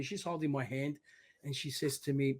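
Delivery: normal talking voice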